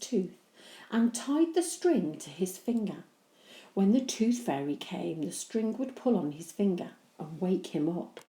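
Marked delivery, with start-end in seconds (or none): none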